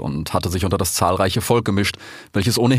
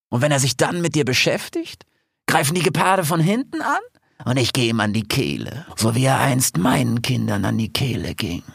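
high-voiced scheming lioness plans the attack; a cunning lioness savouring her plan, pitched high; 7 semitones higher than usual. Aisha plotting the revolt with her pack, high and scheming.

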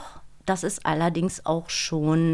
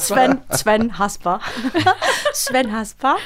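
high, loud, with a laugh between the names; delighted; 3 semitones higher, 4 dB louder, slower than usual. Real, not acted. Delighted laugh while naming her beloved voice partner. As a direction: savour the name with glee.

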